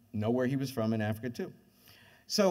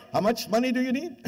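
higher and louder, over-the-top keen; eager sponsor; 11 semitones higher, 5 dB louder than usual. Retold or imitated. Coca-Cola executives offering money, hammed-up eagerness. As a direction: jump at the chance to pay. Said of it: "They go like".

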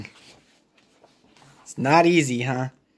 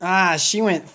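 ordinary delivery

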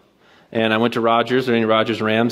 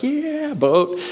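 mock cheer; 13 semitones higher than usual. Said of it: "and like".

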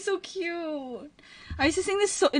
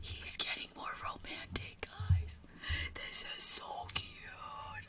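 whisper; 18 dB quieter, slower than usual. Real, not acted. Fangirling while watching his stream.

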